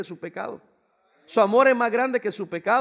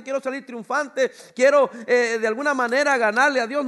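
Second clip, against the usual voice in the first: ordinary delivery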